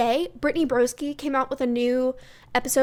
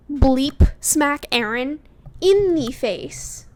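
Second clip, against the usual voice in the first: slow and louder, each word hit hard; slow angry emphasis; 8 dB louder, much slower than usual. Real, not acted. Vlogger fuming at Aaron on the show, slow angry emphasis. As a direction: spit out the threat word by word.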